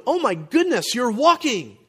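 high-pitched mock wonder; gushing, high-pitched amazement; imagined amazement. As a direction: act out delighted astonishment. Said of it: "they're not going".

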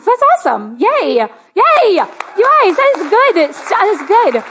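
high, loud celebratory whoops; excited cheering. Real, not acted. Pastor celebrating people healed during worship, excited cheering. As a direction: cheer them on with real delight.

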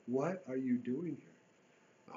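drawn-out question, very slow; a slow, searching question; slow, deliberate question. Acted. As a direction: ask it slowly and gently. Voicing God's question.

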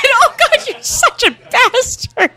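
high, laughing through tears; tearful laughing. Real, not acted. Friend brought up the dog, tearful laughing protest. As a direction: scold a friend while half laughing, half crying. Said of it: "I said I wouldn't cry".

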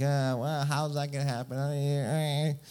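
a touch of mock whine; mild, drawn-out whining imitation; whiny. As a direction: grumble doubtfully in a lightly whiny voice. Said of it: "Kinda whiny, kinda like".

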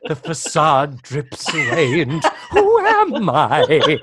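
slow mock-tragic declaration through laughter; melodramatic parody. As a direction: ham it up like a tragic diva.

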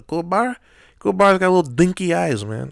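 lightly voiced Chicago accent; a hint of Chicago twang. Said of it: "I'm talking like I'm from Chicago".

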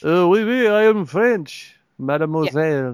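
mock French suitor, very theatrical; bad French accent. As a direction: put on a cartoonish French accent, drawing it out. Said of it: "Mine sounds like a French".

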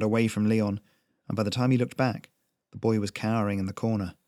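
usual speaking voice

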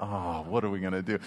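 a touch of a panicked church member; mock fretful churchgoers. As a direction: play the anxious congregant for laughs.

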